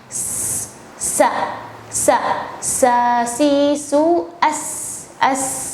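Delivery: sa si su with a soft narrow s; soft hissed s on sa, si, su; soft s demo. Shown as a demonstration. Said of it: "Kalau sin dia lebih soft".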